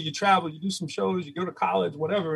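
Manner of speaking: ordinary talk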